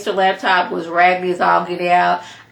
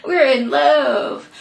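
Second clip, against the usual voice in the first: mocking lovestruck; 6 semitones higher, much slower than usual. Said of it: "She's like".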